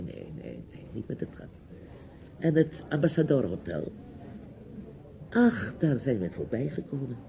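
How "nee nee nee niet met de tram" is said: whisper. Real, not acted. Rejecting the tram.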